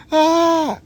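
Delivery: a sudden high wail of loss; short high yelp; high anguished cry. Real, not acted. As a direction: cry out in mock despair.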